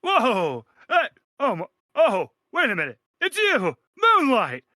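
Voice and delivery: high, loud, overjoyed greeting; delighted old acquaintance. Acted. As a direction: greet a long-lost friend. DM plays Marble NPC greeting.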